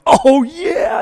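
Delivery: high, loud cheer of approval, acted out; mock eager agreement. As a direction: shout it like a fired-up listener.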